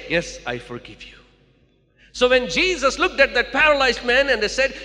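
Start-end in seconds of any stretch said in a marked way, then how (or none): 0.1-1.1 solemn divine pardon. Shown as a demonstration. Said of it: "Only God can say".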